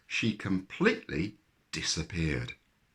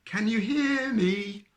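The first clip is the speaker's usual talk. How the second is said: calling down the well; 4 dB louder, slower than usual. A narrator's reading. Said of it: "called Percy".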